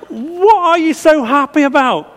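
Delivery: high, puzzled father voice; father's question. Retold or imitated. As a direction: ask it like a baffled dad. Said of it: "he asked".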